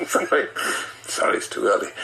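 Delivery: breaking into real laughter; can't stop giggling, apologising through it; laughing fit. Real, not acted. Reader cracks up mid-reading.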